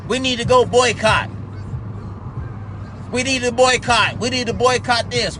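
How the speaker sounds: a mocking, dismissive imitation of the boycott crowd; lightly voiced mock activist; mocking whiny quote. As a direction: parrot them like a tired slogan. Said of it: "with that bullshit".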